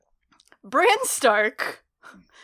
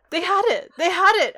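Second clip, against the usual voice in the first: higher-pitched mock exasperation; exasperated disbelief; 5 semitones higher, 3 dB louder than usual. Podcast host acting out watching friends guess, mock exasperated. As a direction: lament it in mild, raised disbelief.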